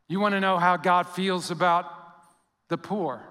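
normal talking voice

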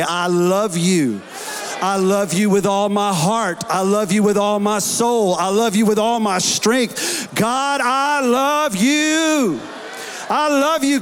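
swelling declaration of love, rising to a cry. Shown as a demonstration. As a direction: build from firm to a full shout of love. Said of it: "bring the volume up a little bit".

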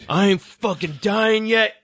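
high, drawn-out acted defiance; mock defiant fighter. Retelling the wooden chair fight, mock defiant cry. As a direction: play the hero refusing to die.

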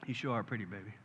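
soft, low sweet talk; tender aside. Real, not acted. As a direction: drop out of preaching to flirt gently with your wife. Tender aside to his wife.

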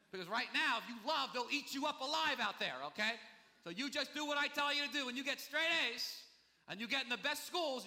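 high, loud, insistent parental ordering; pushy parent lecture. Acted. Parent telling kid to make it before loving, loud insistent lecture.

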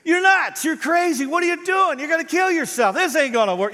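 high, loud mock scolding; mock harsh rebuke. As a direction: blast Moses like a harsh critic. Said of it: "Jethro didn't do that".

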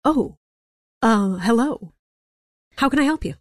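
lightly voiced startled craftsman; a hint of being caught off guard; surprised Rufus.

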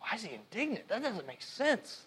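soft, wondering, half to himself; hushed puzzled thought. Retold or imitated. Pastor recalling his own confusion over the text, hushed. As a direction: ask it quietly, as if puzzling it out. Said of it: "And I was like".

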